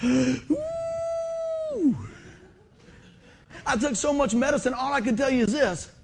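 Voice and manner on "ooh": high sharp gasp of pain, acted; pained gasp cry. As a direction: let out a sudden pained cry. Pain cry while recalling surgery.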